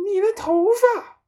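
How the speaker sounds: very high, loud scream; horrified shriek. A narrator's reading. Mother points at her husband's hair, shrieking. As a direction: shriek it in shock. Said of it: "妈妈用发着抖的手指指着她丈夫尖 声叫道".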